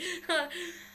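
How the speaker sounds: short high laugh; real, brief giggle. Real, not acted. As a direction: burst of amused laughter at oneself. Laughs at own weird voice.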